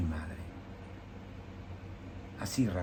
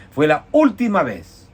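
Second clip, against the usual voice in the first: preaching emphasis; 5 semitones higher, 7 dB louder, slower than usual. Real, not acted.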